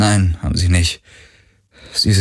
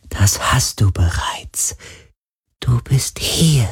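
ghostly whisper; 5 semitones higher, slower than usual. Acted.

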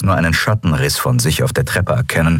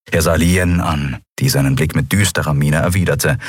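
ordinary delivery, nothing marked

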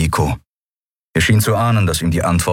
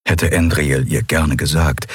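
ordinary delivery, nothing marked

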